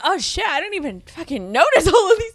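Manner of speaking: hammed-up taken-aback reaction; mock dismay. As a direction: play startled and put out. Imagines reacting to listed flaws.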